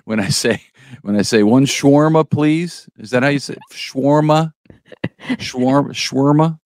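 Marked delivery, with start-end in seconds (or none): 1.4-6.6 put-on higher voice, questioning; playful trial pronunciation. Customer ordering a shawarma, trying out how to say it, playfully pitched up. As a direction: try the word out as if ordering, pitched up.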